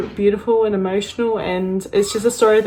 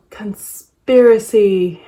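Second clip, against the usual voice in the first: gasping reveal; 6 dB louder, slower than usual. Real, not acted. Gasps at big palette.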